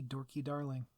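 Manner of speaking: ordinary delivery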